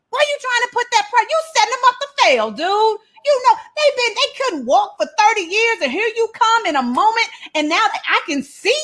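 high, fast complaining voice as the healed man; mock-aggrieved healed man, voiced high; whining healed man. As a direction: whine at Jesus that he set you up.

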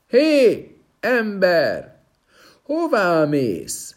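high, loud hail of a stone man; shouting after him. Acted. Stone man yells after the prince, loud and high. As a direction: call out sharply after the passing prince. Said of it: "az hirtelen utána kiáltott".

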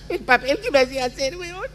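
high laughing complaint, voice cracking; laughing scold. Acted. Wife laughs while scolding her husband, voice cracking. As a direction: scold him through laughter.

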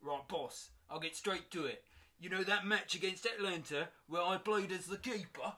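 high, loud cockney player; eager cockney lad to his boss. Acted. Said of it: "Kyle is a bit cockney".

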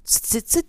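high, loud tsit tsit tsit of a saw; sawing noise. Retold or imitated. Telling children the story, a high loud sawing sound. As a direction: mimic a hand saw at work.

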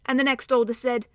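plain reading voice

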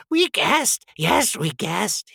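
high, strained creature voice, drawn out; Gollum. A narrator's reading. Creature admitting he guessed, high strained voice. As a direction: squeeze it out high and hissy. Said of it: "he whispered".

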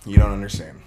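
ordinary delivery

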